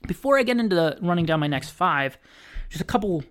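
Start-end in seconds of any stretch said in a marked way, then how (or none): 2.7-3.2 words pushed out through a burp; talking while burping. Real, not acted. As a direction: burp breaking the phrase. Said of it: "sorry, I'm burping".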